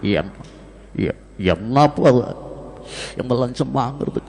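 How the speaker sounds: low, hushed movie-star impersonation; halting, soft and deep character voice; Kamal Haasan film character. Acted. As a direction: sink low and quiet for a Tamil film role. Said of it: "രണ്ട് കഥാപാത്രത്തിന്റെയും വേറിട്ട ശബ്ദം".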